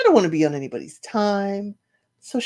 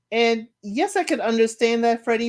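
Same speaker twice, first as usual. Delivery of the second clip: ordinary talk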